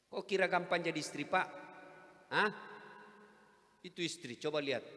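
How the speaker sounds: ordinary talk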